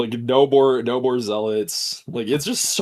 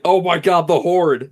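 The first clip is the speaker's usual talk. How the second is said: mock alarm; 6 semitones higher, 6 dB louder than usual. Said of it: "I was like".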